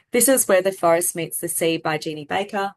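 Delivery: mildly monotone mock student read-aloud; no expression. Shown as a demonstration. Student reading a picture book to the class, with no expression. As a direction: read word by word without feeling. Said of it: "they were reading with no expression".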